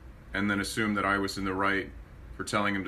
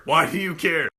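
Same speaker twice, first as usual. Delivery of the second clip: louder insistent "Why do you care?"; louder repeat; 7 semitones higher, 8 dB louder than usual. Acted. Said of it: "repeated line, louder".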